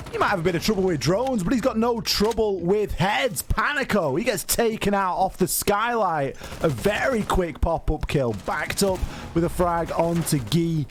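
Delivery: high, quick play-by-play call; esports caster. Acted. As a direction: call the action live as it happens. Live play-by-play of the round.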